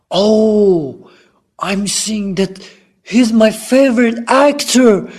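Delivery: high, gushing fan voice; excited fan. Acted. Fan spots a favorite actor, excited. Acted fan spotting a star.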